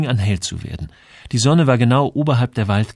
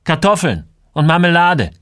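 loud, high, curt-tempered order; angry; 9 semitones higher, 8 dB louder than usual. A narrator's reading. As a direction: order groceries while fuming. Angry Joel gives his shopping order. (wütend und laut bestellt)

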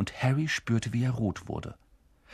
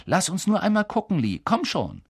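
eager pleading; 9 semitones higher, 3 dB louder than usual. A narrator's reading. Eager crowd begging to look.

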